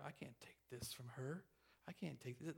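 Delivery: hushed whisper of her private thoughts; inner thoughts whispered. Retold or imitated. Debbie torn inside over the gift, whispering.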